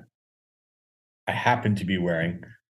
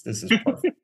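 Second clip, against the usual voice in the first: mock delight, pitched much higher; 13 semitones higher than usual. Reacting to hearing the local pronunciation, high mock delight. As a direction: squeal it out, half-joking delight. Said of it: "I'm like".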